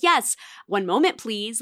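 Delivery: ordinary talk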